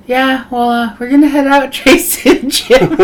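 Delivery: a slightly forced giggly exit line; nervous laughing excuse. Retold or imitated. Escaping the room, nervous excuse.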